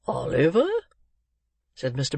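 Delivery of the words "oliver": put-on puzzled old gentleman. Old gentleman queries the name.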